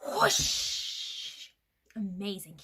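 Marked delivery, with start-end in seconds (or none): 0.1-1.5 long airy whoosh; owl whoosh. Shown as a demonstration. Storyteller showing children the sound, long whoosh. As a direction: whoosh with a drawn-out rush of breath. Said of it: "Can you make that sound with me?".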